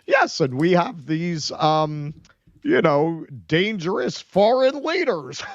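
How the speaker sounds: high, slow, sugary newscaster voice; Rachel Maddow impression. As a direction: coo it like a cute TV anchor. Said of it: "her cute little Rachel Maddow thing".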